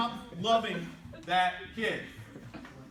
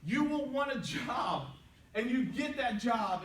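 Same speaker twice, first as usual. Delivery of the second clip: ordinary delivery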